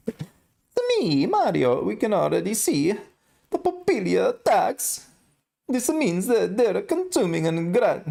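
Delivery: high falsetto mock-Italian accent, Mario style. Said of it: "I gotta do the Mario accent now".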